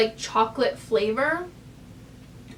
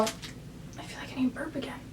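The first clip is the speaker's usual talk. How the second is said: whispered aside; whispering; 11 dB quieter than usual. Real, not acted. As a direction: breathe it out as a whisper. Aside about burping.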